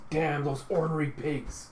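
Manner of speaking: slow, mildly heated curse; furious. Retold or imitated. Jocko curses as gas seeps in, furious. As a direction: grumble it out with mock fury. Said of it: "Jocko's getting furious".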